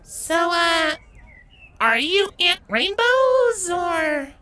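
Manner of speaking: high, slow, hesitant question; gingerly. A narrator's reading. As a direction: ask it carefully. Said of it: "Avia gingerly inquired".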